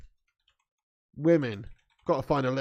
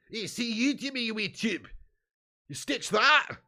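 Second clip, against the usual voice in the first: high-pitched mock Scottish accent; hammed-up Scottish dinner companion; 5 semitones higher than usual. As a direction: imitate a Scottish date in a high, broad accent. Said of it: "I like an accent".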